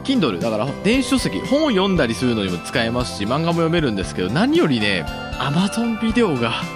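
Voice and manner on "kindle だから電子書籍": normal talking voice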